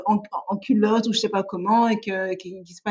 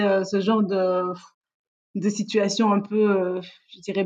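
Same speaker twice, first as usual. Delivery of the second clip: ordinary talk, nothing marked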